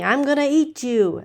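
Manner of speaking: the troll threatening the little goat, loud and menacing. A narrator's reading. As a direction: growl it out like a troll under the bridge. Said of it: "the troll pops up saying".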